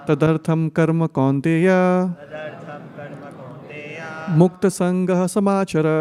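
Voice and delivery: whole verse recited fast and flowing; fluent recitation. Shown as a demonstration. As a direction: run the words together quickly. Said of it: "one word at a time".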